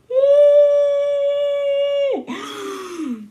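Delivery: long high shrieking gasp; excited gasp. Real, not acted. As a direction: gasp out loud in delight. Reacting to Colin scene.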